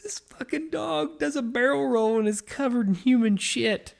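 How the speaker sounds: high-pitched, half-laughing through the words; can barely get it out for laughing; laughing disbelief. Real, not acted. Telling a friend about the dog's barrel roll, laughing in disbelief. Retelling the absurd dog mess.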